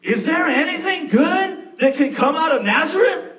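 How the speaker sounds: high, loud skeptical question; mild scoff. Acted. Nathaniel doubting Jesus' hometown, high and scoffing. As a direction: scoff at the idea lightly.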